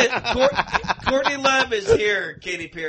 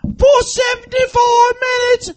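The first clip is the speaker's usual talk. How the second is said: shouting, higher and louder; angry wife; 7 semitones higher, 6 dB louder than usual. Acted. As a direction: scold him like a furious wife. Acted spouse quarrel as celebs.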